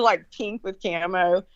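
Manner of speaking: ordinary talk, nothing marked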